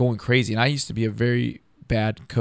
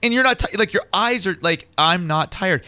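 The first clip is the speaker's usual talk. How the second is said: high, loud, agitated retelling; wired insistence; 9 semitones higher, 6 dB louder than usual. Retold or imitated. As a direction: relive the sleepless rush, voice pitched way up. Relives sleepless manic energy.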